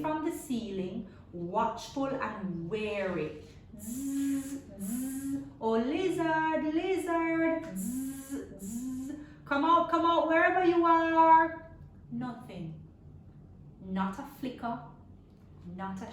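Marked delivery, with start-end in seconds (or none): 3.8-11.7 1 buzzing mosquito call. Acted. Mosquito calling for hidden lizard.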